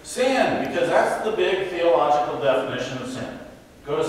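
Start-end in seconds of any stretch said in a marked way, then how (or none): 0.0-0.6 "Sin!" called out high like a game chant; family yell. Retold or imitated. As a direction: shout it playfully at the miss. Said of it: "everybody in the family yells".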